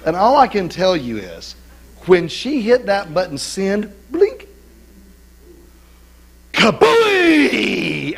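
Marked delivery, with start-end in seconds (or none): 4.1-8.1 playful boom; mock explosion. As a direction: sell the blast like a cartoon. Describing explosive reaction.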